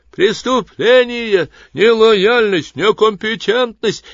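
high, slow, loud accusing screech; shrill screeching voices. A narrator's reading. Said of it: "наперебой на разные лады заверещали". (визгливый обвиняющий хор)

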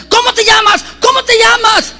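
high, loud demanding shout; retold shouted question. Leader shouting at the girl to name the demon, high and loud. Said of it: "gritándole y preguntándole".

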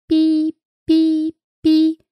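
high beeping bracelet noise; shrill beeping. Acted. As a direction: squeak it like an electronic alarm. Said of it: "противный писк". (противный писк)

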